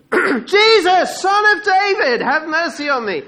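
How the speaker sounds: high, loud full-voiced shout; insistent high calling, falling at the end; crying out. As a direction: bellow the plea over everyone. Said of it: "He just carries on".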